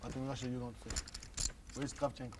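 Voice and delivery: put-on Russian accent. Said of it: "That's a Russian accent".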